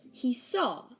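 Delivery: usual speaking voice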